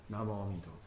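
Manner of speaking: low, very soft Buddha-name chant; hushed, low devotional murmur; slow settled recitation. Shown as a demonstration. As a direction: recite inwardly, barely voiced, letting the mind settle. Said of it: "轻轻的提着".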